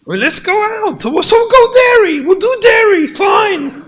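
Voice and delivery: very high, loud angry surrender; shouted giving in. Acted. Husband shouting at his wife that they'll go, fed up. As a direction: yell that you'll go, fed up.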